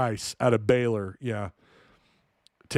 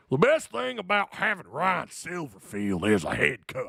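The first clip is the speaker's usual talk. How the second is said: mock angry Arkansas accent, pitched up; angry Arkansan voice; 3 semitones higher than usual. Shown as a demonstration. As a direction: read it like a riled-up Arkansan. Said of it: "in my, my angry Arkansan voice".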